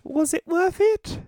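tiny, very high, slow squeak of a voice; squeaky cartoon-dog falsetto; talking dog voice. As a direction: put on a high falsetto for a little dog asking a question. Voices the dog in imagined scene.

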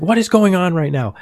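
loud, high imitation of dad losing it; yelling, frustrated. Dad yells at grandma over the beeping, imitated. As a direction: replay an exasperated dad's yell. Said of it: "basically yells at her".

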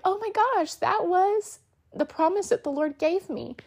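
rising in wonder at the answered promise; amazed joy. Retold or imitated. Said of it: "I cry even when I tell it".

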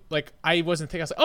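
ordinary talk, nothing marked

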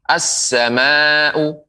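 slow "As-sama'u", held s and long level a; doubled s. Shown as a demonstration. Quran teacher shows sin with tasydid, doubled s. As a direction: lean on the s and stretch "As-sama'u". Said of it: "sehingga sin bertasydid".

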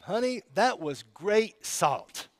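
slow, hammed-up praise to the wife for the salt; mock praise to wife. Husband thanking his wife for corn, mock silly praise. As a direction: gush a little too much over the salt. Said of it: "Do I say, "Honey".

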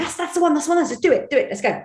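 eager, high-pitched "that's the one"; excited approval. Retold or imitated. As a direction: cheer on the take like a thrilled producer. Producer excitedly approving the take.

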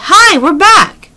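slow, high sing-out greeting; calling out. A narrator's reading. Annie greets the invisible children, high calling voice. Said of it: "she called to the invisible children".

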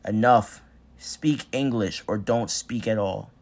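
furious master's bellow; angry shout. Acted. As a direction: shout him down, slow and hard.

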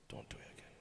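soft breathy whisper, boss leaning in close. Retold or imitated. Boss whispering a private warning into your ear. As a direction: hush it like a secret warning. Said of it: "he just whispers in your ear".

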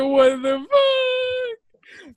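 high, loud, very drawn-out exclamation; shocked outcry. Retold or imitated. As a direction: stretch it out in mock horror. Retold shock at being seen exposed.

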